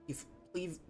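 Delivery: ordinary talk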